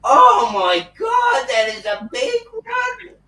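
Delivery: high, slow, loud gasp of shock; mock shocked shriek. The officer seeing the giant lollipop, shrieking in mock shock. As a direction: shriek it like she's seen something huge. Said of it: "she's like".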